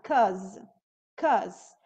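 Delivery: 'cause dito curto como "kóz"; short form 'cause. Shown as a demonstration. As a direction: clip because down to "kóz". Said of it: "or just 'cause I don't like". (forma curta de because)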